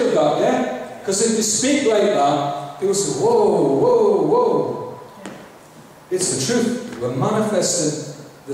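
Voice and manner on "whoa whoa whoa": mock startled whoa. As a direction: imitate people recoiling in surprise. People reacting to bold speech.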